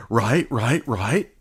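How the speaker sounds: lightly mimicked "right, right, right"; a light mocking imitation of debaters saying right; imitating a verbal tic. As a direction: echo their habit of saying right. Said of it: "will always say".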